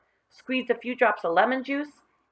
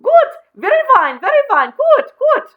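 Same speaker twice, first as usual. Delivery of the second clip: much higher, much louder birdlike cry; crazed crying out; 12 dB louder than usual. Acted. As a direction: squawk it like a mad bird, hopping.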